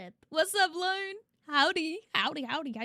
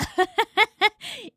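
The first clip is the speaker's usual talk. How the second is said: a loud, higher burst of laughter; 7 semitones higher, 8 dB louder than usual. Real, not acted. Laughs at a chat message.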